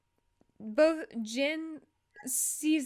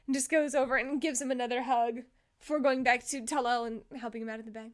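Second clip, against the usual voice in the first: ordinary talk, nothing marked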